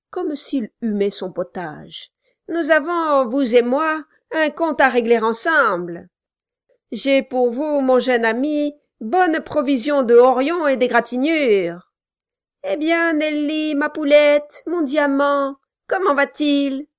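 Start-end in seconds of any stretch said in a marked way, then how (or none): 2.5-11.9 promets-lui des coups avec délice; nain menaçant, jubilant. A narrator's reading. Quilp menace le garçon. 12.6-16.9 mielleux, plus aigu; fausse tendresse de nain. A narrator's reading. Quilp cajole Nelly.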